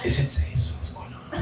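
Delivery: hushed, laughing whisper of nervous onlookers; whispered mock diners. Son mocking the nervous diners, giggling whisper. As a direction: whisper it like they're scared of you. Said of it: "looking at us like".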